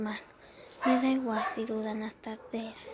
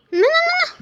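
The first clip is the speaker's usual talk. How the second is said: high, loud piercing noes; a high shrieking "no, no, no"; desperate scream; 15 dB louder than usual. Acted. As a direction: scream it out in despair. Character's despairing outburst.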